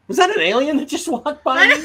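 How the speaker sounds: high, loud astonished question; startled double take. Acted. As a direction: blurt it out in amazed disbelief. Acts out people's startled double take.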